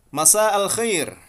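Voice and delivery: "Masa al Khair" called out loud and high; Fusha pronunciation. Shown as a demonstration. Teacher announcing the formal evening greeting loudly. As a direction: proclaim "Masa al Khair" like a textbook model.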